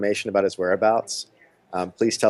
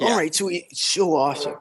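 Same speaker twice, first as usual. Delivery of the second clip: high-pitched impression of an absent friend; Bob; 9 semitones higher than usual. Co-host does his best imitation of their missing friend, high put-on voice. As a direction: put on a much higher voice, imitating someone. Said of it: "gonna do my best Bob imitation".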